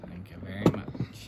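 deep toy voice. Pretend. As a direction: drop the voice way down for the toy. Toy character voiced low.

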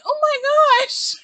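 high, slow, drawn-out gasp of disbelief; shocked. Real, not acted. Reacting to Cheyenne bullying her brother, shocked. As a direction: stretch it out in shock.

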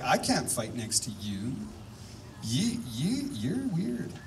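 softly imitated fussy soldier, hesitant; mock reluctance. Soldier refusing the man beside him in battle, mock reluctant.

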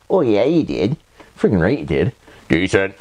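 ordinary delivery

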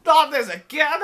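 high, loud startled cry; startled outburst. Real, not acted. Gamer caught off guard by a Spectre, startled yelling. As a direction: jump at the sudden monster. Startled by monster behind him.

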